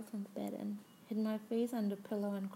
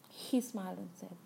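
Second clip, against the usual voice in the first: usual speaking voice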